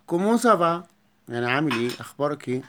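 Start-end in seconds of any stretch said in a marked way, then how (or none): 0.1-0.9 higher, louder كومون سافا; loud, lifted classroom greeting; bright call. Shown as a demonstration. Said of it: "lively repeat for class".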